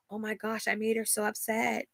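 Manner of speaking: light, breathy mock of fretful people; mocking onlookers. People who see her crying, mimicked in a hushed fretful voice. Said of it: "people are like".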